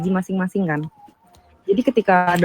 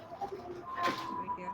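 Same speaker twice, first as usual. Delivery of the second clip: barely audible, far off; as if through water; muffled faint voice; 10 semitones higher, 19 dB quieter than usual. Real, not acted. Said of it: "Kecil banget".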